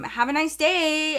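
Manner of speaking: high, slow, puzzled send-off; confused goodbye. Retold or imitated. Store girl wishes the leaving group well, imitated confused goodbye. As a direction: imitate an awkwardly polite farewell. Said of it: "she was genuinely confused".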